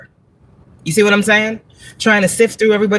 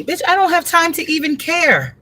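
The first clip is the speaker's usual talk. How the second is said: much higher, exasperated brush-off; high exasperated retort; 11 semitones higher than usual. Real, not acted. Reader answering petty critics, high and exasperated.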